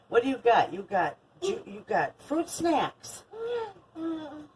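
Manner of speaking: put-on high, sing-song baby talk. Grandma cooing at her granddaughter, high baby talk. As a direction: coo it up high to a toddler.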